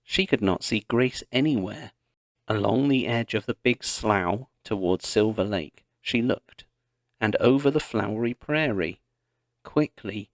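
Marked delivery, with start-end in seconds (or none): none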